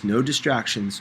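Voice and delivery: usual speaking voice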